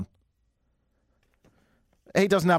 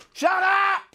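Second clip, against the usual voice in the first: high-pitched mock outburst; outraged yell. Host reacting to Apple scrapping the headphone jack, outraged yell.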